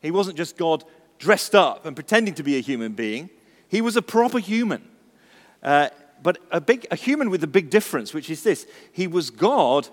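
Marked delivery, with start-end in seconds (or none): none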